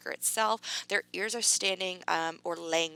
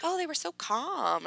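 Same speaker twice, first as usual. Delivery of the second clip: high, sing-song mock of a clueless owner; lightly mocking an owner's high-pitched praise; mock dog owner; 10 semitones higher than usual. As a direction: parrot the owner in a high silly voice. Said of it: "and they're like".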